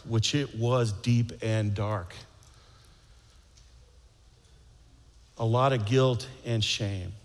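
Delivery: reflective preaching. Acted. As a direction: linger on each phrase, gently. Sermon's closing message.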